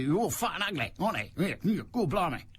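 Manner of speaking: mock cockney. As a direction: send up a celebrity with hammed-up cockney. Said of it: "he pretends to be a cockney".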